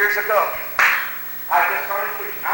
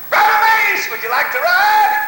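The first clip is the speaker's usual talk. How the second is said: high, loud, stretched-out call; 5 dB louder, slower than usual. Retold or imitated. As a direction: holler an offer from the car. Pastor calls from the car.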